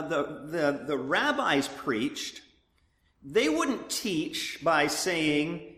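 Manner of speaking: normal talking voice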